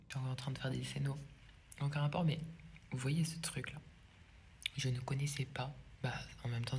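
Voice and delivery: soft, half-whispered commentary; quiet murmured chat while snacking; hushed talk. Real, not acted. Talks hushed while eating.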